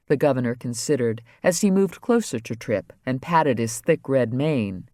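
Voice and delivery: ordinary delivery, nothing marked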